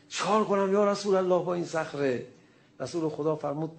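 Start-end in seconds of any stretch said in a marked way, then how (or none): 0.1-2.3 excited cry. Retold or imitated. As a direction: call out thrilled to the Prophet. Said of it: "فریاد زد که".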